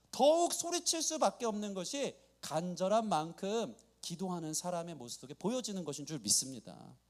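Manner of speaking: normal talking voice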